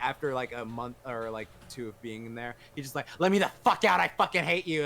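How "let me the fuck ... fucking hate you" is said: loud, higher-pitched mock outburst; retold angry outburst. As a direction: act out a patient yelling to be released. Retelling patient's angry outburst while locked up.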